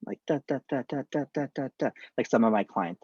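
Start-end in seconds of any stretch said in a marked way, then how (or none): 0.3-1.9 monotone da da babble; empty chatter syllables. Shown as a demonstration. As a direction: babble without meaning. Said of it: "no meaning or empathy".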